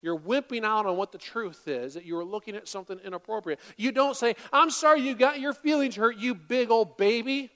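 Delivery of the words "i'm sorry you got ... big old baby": louder, sneering fake sorry; sarcastic put-down apology dripping with scorn; mocking a spouse's fake apology, louder and sneering; mock snide apology. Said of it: "That's not an apology".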